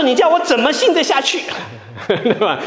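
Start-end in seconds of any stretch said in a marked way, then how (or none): 0.0-1.4 high exasperated sister with a laugh in it; upset sister asking the pastor how she can believe, high and amused; complaining church sister. Retold or imitated. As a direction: toss up the question, half laughing and fed up. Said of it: "她说".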